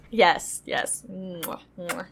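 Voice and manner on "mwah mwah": chef's kiss sound. Acted. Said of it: "Chef's kiss".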